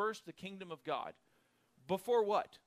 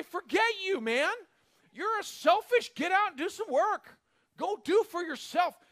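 higher, louder scolding; fed-up friends; 10 semitones higher, 8 dB louder than usual. Pretend. Friends shout down a selfish moocher, angry and loud. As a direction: tell the moocher off.

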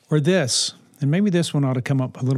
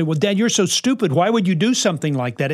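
mock yelling teen; 3 semitones higher than usual. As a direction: play the kid lashing back, half-hearted. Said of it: "if they yell at you".

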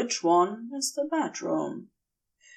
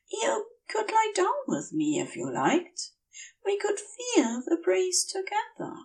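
Aziraphale voice; 11 semitones higher, 4 dB quieter than usual. Acted. Aziraphale's dialogue, higher voice.

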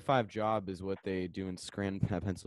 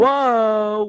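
surprised; 16 dB louder than usual. Real, not acted. Reacting to ending podcast.

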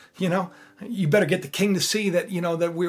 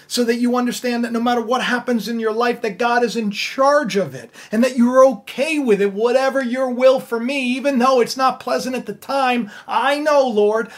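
lifted, louder preacher's build; fervent preaching; 3 semitones higher, 4 dB louder than usual. Acted. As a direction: preach it with rising conviction. Preaches God's will.

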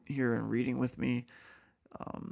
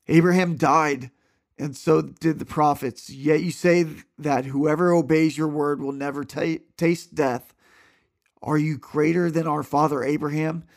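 clear, louder, steady Bible reading voice; scripture reading; 4 semitones higher, 9 dB louder than usual. A narrator's reading. Reading John aloud to listeners, earnest scripture voice. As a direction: read the passage out with conviction.

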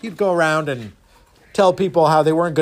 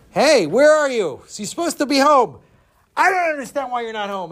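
high, put-out complaint at a closed door; indignant calling out; 8 semitones higher, 3 dB louder than usual. Acted. Man knocking at an empty house, indignant. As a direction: call out high and affronted. Finds nobody home, upset.

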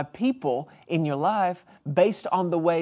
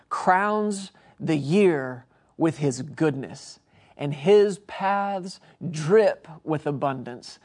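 slow scripture reading; much slower than usual. Acted. Quoting scripture slowly.

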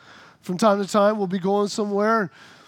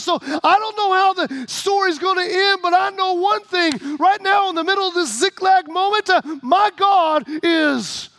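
high and building to a shouted close; preacher's rising proclamation; preaching climax; 7 semitones higher, 3 dB louder than usual. Acted. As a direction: rise and drive it home.

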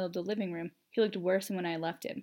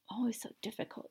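whispered, much softer grumble; mumbling; 2 semitones higher, 8 dB quieter than usual. A narrator's reading. Impatient Sirius complaining about Emmeline, mumbling softly. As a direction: mumble it under your breath. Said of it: "he mumbled".

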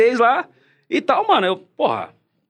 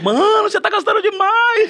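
high mocking quote; 14 semitones higher than usual. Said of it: "O Conde falou".

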